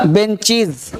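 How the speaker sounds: "बेंचीज" falling, with long ee; correct -IZ ending. Shown as a demonstration. Teacher drilling the class, "बेंचीज" ending -cheez. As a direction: say "बेंचीज" with a final z.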